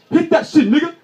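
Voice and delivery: loud high hype yell; cheering shout. Retold or imitated. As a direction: hype him up. Buddy cheering loudly.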